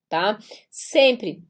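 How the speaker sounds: ordinary delivery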